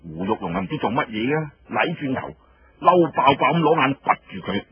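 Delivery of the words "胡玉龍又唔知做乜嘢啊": usual speaking voice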